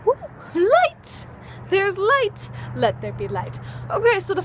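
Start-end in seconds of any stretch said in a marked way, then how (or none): 0.0-1.3 real joy as the light comes on; excited cry. Real, not acted. Lights come back on. 1.7-2.5 high, happy exclaim; thrilled the light is back; excited. Real, not acted. 2.7-3.6 lower, falling, grand; a hint of a biblical decree; mock solemn proclamation. Mock biblical proclamation after excitement.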